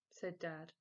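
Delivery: usual speaking voice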